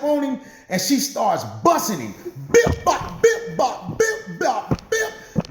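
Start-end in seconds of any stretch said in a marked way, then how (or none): 2.5-5.5 high, rapid-fire punch noises; comic fight sound effects in a high voice; imitated punches. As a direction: mimic a flurry of blows, pitched way up. Imitating the blows of a beating.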